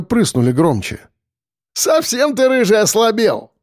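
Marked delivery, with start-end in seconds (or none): 1.7-3.5 high-pitched jeer at a mate; snorting mockery. A narrator's reading. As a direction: scoff at your struggling pal. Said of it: "фыркнул Худой".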